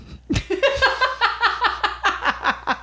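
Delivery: high, pealing run of laughter; long laugh. Real, not acted. Amused by the co-host's talk, a long high laugh.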